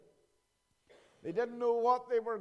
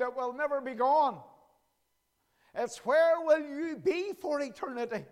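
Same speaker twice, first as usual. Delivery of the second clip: usual speaking voice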